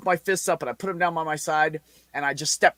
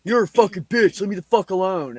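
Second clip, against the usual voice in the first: put-on angry kid; 4 semitones higher, 4 dB louder than usual. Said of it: "this kid's like".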